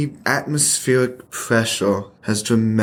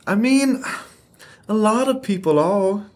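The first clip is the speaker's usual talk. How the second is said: insistent; 8 semitones higher than usual. Acted. Pushing to move again.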